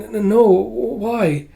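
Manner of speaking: high, slow, stuttering reply; nervous stammer. Acted. Kippa answers the doctor cat, nervous stammer.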